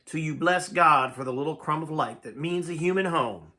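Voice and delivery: ordinary delivery, nothing marked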